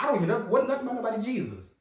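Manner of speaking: loud, high-pitched protest; exasperated, high-voiced complaint, played up for the story; mock exasperation. Acting out past disbelief at choir.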